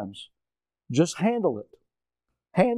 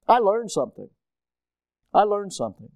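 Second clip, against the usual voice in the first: usual speaking voice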